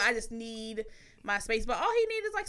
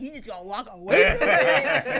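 mock partner; 7 dB louder than usual. Partner accusing her of walking away, mock indignant. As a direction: imitate him calling her out. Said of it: "he's like".

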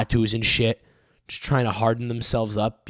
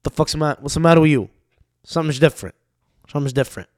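Tony Soprano; 4 dB louder, faster than usual. Retold or imitated. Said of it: "When he goes".

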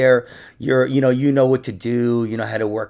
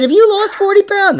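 high, loud fawning question; hammed-up psychic voice, pitched up and loud; mock flattering psychic; 8 dB louder than usual. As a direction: gush like a fake psychic flattering a client.

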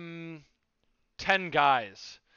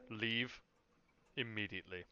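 guard's cold order; 6 semitones lower, 14 dB quieter than usual. Acted. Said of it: "And he just says".

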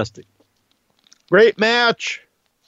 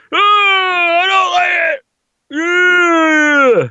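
mocking bitter fan; 8 semitones higher, 3 dB louder, much slower than usual. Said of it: "They're like".

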